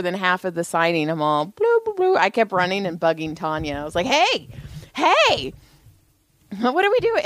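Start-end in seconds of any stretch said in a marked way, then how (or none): 1.5-2.2 high-pitched nonsense babbling; silly babble. Retold or imitated. Acting out idle boredom at signing. 4.1-7.2 high, needling singsong call; playful pestering. Retold or imitated. Acting out pestering Tanya.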